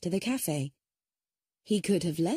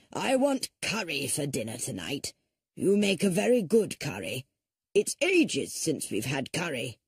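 put-on a lower, demanding old father voice; grumpy old man; 3 semitones lower than usual. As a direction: order your dinner like a spoilt old tyrant. Old father orders curry from Lucy.

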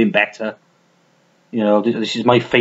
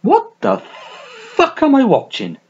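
high, slow, stunned disbelief; baffled inner voice; 11 semitones higher, slower than usual. Retold or imitated. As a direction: act out baffled confusion at the screen. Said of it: "you're watching thinking".

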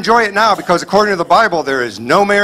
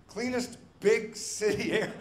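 slow ironic repeat; 5 semitones higher, 17 dB quieter, slower than usual. Retold or imitated. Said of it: "repeating the slogan slowly".

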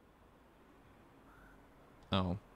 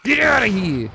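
put-on loud Jersey accent; 8 semitones higher, 6 dB louder than usual. Right after Jersey accent talk.

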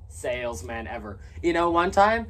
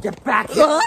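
high, loud villain shout after someone; hammy shout; 11 semitones higher, 12 dB louder than usual. Acted. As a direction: yell after the fleeing customer. Chasing the customer.

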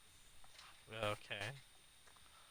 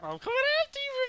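fast, high falsetto threat; mock menace; faster than usual. Killer vowing revenge after pocket knife attack, squeaky menace.

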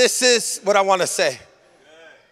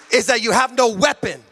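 ordinary delivery

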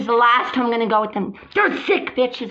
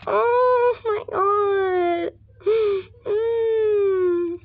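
high-pitched sobs with gasps; crying; 9 semitones higher, much slower than usual. Real, not acted. Breaking down after a bad day, gasping sobs. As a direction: cry out between gulps of air.

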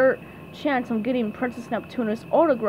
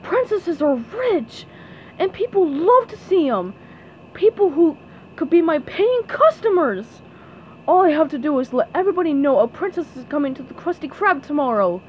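raised, relishing voice, speeding up at the end; scheming crab boss; 4 semitones higher, 4 dB louder than usual. Acted. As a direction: plot a moneymaking scheme aloud.

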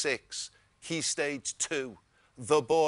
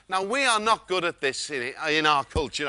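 usual speaking voice

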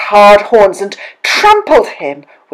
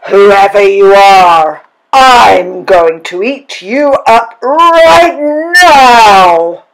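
very loud, raised, furious-troll roar; troll's roar; 3 semitones higher, 8 dB louder, slower than usual. A narrator's reading. Troll threatens the biggest goat, roaring. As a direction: roar the threat, building to the end. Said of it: "roared the troll".

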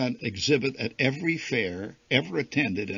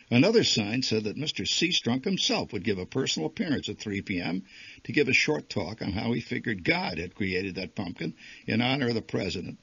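plain reading voice

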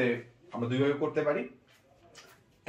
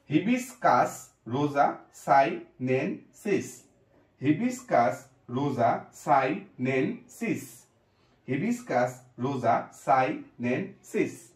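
slow drill; slower than usual. Shown as a demonstration. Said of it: "teacher drills the full name".

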